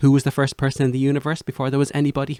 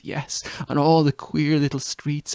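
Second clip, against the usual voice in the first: normal talking voice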